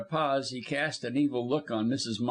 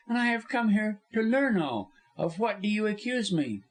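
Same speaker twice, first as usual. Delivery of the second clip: high, controlled woman's voice; firm, pitched-up composure; steady challenge; 9 semitones higher than usual. A narrator's reading. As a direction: demand the charge without wavering. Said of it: "but in a steady voice".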